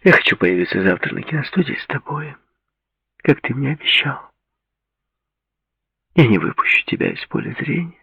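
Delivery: put-on sweet, insistent young woman; Claudia's voice. A narrator's reading.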